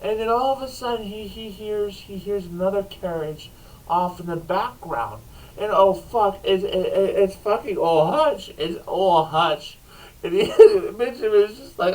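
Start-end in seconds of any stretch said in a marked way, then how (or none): none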